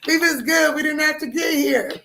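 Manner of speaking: high, aggrieved whine; hammed-up high-voiced protest; mock wailing plea. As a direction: plead as if forced into it. Acting out the crying protest.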